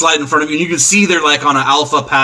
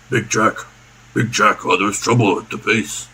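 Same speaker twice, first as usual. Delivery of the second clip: low, softer tough-guy voice; big henchman; 5 semitones lower, 4 dB quieter than usual. Acted. Big club-carrying guy reporting trouble to Big Jack, low. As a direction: report in a lowered heavy's voice. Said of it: "four guys with".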